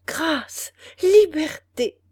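high, loud enraptured echo of liberté; delighted repetition. A narrator's reading. Ethel echoes the king's pardon, thrilled and high. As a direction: repeat Grâce, liberté in thrilled disbelief. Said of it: "répéta Ethel ravie".

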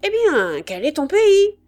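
loud, high insistent question; impatient demand. A narrator's reading. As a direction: press her hard for the answer. Sergeant presses the woman to answer. (question impatiente et forte)

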